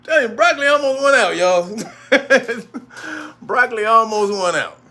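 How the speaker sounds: laughing through the words, voice cracking up high. Real, not acted. Telling viewers about the broccoli, laughing hard. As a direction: crack up while you admit it.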